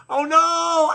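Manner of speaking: high, loud crowd panic; mock screaming. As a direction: wail and shriek as the cart bears down. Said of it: "They're screaming".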